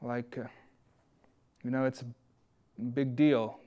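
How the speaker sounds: slow, hushed and grave; somber. Acted. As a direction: mourn it with long pauses. Somber reflection, long pauses.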